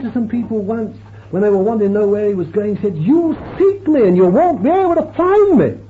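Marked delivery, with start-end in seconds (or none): 3.0-5.8 solemn warning. Acted. Jesus quoted in preaching climax.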